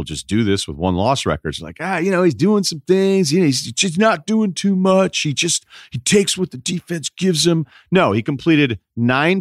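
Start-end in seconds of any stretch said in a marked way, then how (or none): 1.8-7.6 high-pitched mocking fan voice; mocking Flacco apologist. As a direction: make excuses in a much higher put-on voice. Mimics Flacco's defenders making excuses.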